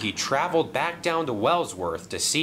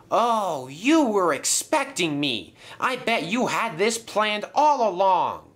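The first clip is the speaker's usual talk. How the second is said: accusing; 8 semitones higher, 3 dB louder than usual. Acted. Acted confrontation with the villain.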